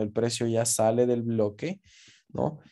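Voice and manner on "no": ordinary talk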